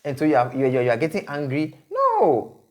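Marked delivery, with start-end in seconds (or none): 1.9-2.6 high, sharp "No"; emphatic no. Real, not acted. Host to stressed bride, emphatic high no. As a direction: cut them off with a high "No".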